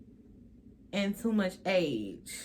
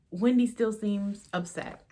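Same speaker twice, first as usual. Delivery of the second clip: ordinary talk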